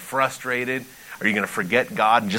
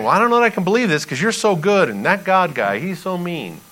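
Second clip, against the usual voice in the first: higher-pitched mock objection; doubting protest; 7 semitones higher, 4 dB louder than usual. Pastor voicing doubters objecting to Jesus, protesting. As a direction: push back like an unconvinced listener.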